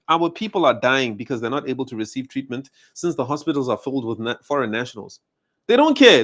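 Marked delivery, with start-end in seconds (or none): 5.7-6.2 high, loud furious burst on "They don't care"; angry outburst. Real, not acted. Fed up with foreigners in hospitals, a furious shout. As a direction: explode with it.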